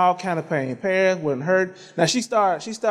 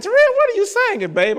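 high-pitched mimicked wife's question; put-on high voice of a wife; imitating wife; 13 semitones higher, 4 dB louder than usual. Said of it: "Toya said".

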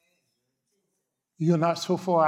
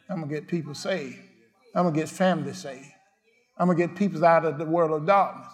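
ordinary delivery

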